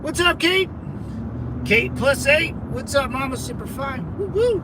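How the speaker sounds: high, bright shout-out; greeting fans in chat with a whoop; excited greeting. Real, not acted. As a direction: hype up a viewer by name.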